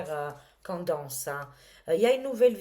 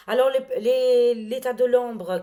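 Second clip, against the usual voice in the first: loud burst; 5 semitones higher, 9 dB louder than usual. Real, not acted. Sudden outburst about the live broadcast.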